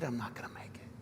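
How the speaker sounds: breathless whisper fading away; despairing whisper. Pretend. Listener lost in the dark ride, giving up in a whisper. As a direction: let the words die out in a whisper. Imagined listener losing hope.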